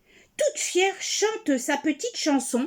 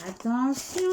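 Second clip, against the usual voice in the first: plain reading voice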